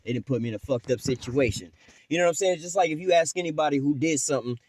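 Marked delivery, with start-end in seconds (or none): 0.0-1.7 quiet, low, giggling it off; nervous giggle. Retold or imitated. Guilty man laughing off the question, quiet giggle. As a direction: laugh it off under your breath. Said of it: "laughing and giggling it off".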